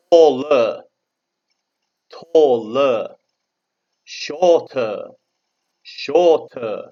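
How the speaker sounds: loud, clear teacher's model with falling ends; model for repetition. Shown as a demonstration. Teacher has pupils repeat comparatives, loud clear modelling. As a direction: call out each word for the class to echo. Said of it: "You say for me".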